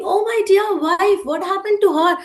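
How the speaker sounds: mild storybook shout of distress; upset shouting. A narrator's reading. Woodcutter hearing his wife is hurt, upset shouting. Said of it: "He started shouting".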